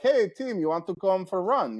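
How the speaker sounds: high, bright hammed-up French Canadian accent. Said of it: "I'm doing a terrible French Canadian accent".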